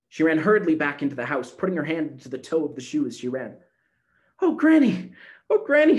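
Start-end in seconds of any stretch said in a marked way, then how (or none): 4.4-6.0 high, loud thrilled cry; excited exclaim. Acted. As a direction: burst out with delight. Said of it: "she exclaimed".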